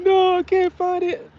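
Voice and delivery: put-on very high, slow child's wail; imitated upset child. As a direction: draw it out like a distressed little boy. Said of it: "he instantly has a lisp".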